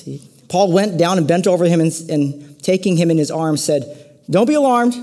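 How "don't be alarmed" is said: high, loud call not to panic; acted-out hasty reassurance, pitched well up; raised voice, pretending all is under control; mock urgent reassurance. Acting out Paul over fallen youth.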